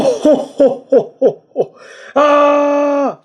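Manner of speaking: high staccato ho-ho-ho with a held "ah"; villain laugh. Acted. Listing the figure's faces, high mock villain laugh. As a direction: mimic a cartoon villain's laughing face.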